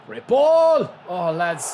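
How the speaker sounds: high, loud burst of praise; excited shout. Real, not acted. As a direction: yell it out in delight. Excited gaming commentary outburst.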